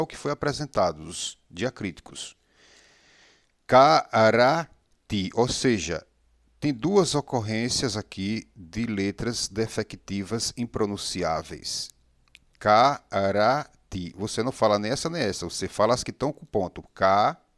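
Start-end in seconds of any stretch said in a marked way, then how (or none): 3.7-5.3 Karati drawn out syllable by syllable, ka-a-ra-ti; slow syllable reading. Shown as a demonstration. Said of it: "dizemos Karati". 12.6-14.1 Karati said slowly, syllable by syllable; slow model reading. Shown as a demonstration. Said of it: "dizemos Karati".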